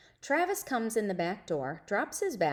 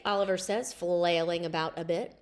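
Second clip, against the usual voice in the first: ordinary delivery, nothing marked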